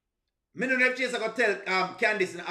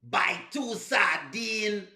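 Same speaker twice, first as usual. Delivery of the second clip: very slow, over-separated fake Jamaican "two sardine"; imitating a bad Jamaican accent, stretching "sardine"; mock Jamaican accent; much slower than usual. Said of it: "See, it's different".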